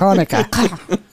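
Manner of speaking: slow, drawn Hanukkah; drawn-out word. Shown as a demonstration. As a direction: stretch Hanukkah out slowly. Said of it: "Why do you drag it out?".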